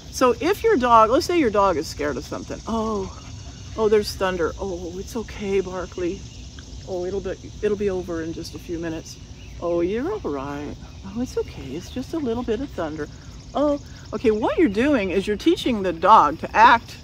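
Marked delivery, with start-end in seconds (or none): 2.7-13.8 soft, hushed coddling voice; mock soothing. Trainer imitates an owner hushing a dog scared of thunder, softly. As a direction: murmur comfort to a frightened dog.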